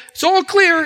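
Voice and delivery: high-pitched call down the line; re-enacted helper's call; retold yell back. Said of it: "somebody else would yell back".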